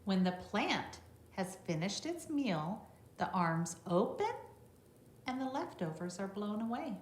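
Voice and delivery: bright read-aloud voice for a class; reading to children. Acted. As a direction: read it out clearly for the little ones. Reads the book text to children.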